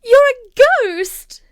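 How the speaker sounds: high, loud, slow frightened cry; child crying out at the ghost; terrified cry. A narrator's reading. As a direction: shriek it slowly in terror.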